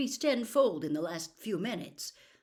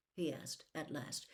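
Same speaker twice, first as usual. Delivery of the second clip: usual speaking voice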